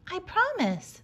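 high, small crab voice; little crab. A narrator's reading. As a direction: promise like a small creature.

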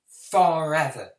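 loud, drawn-out cry of doom; emphatic outburst. Acted. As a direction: hurl the word out and stretch it. Doom speech reaches its climax.

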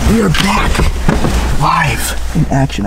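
announcer. Acted.

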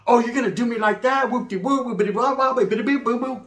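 high-pitched babbling complaint; hammed-up indignant chatter; mock girlfriend rant. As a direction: imitate her nagging as rolling nonsense. Acted girlfriend ranting in gibberish.

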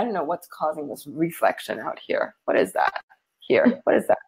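half-voiced puzzled mutter under the breath; asking herself what the glare is, barely voicing it; puzzled aside, mostly breath. Real, not acted. Puzzled at a screen reflection.

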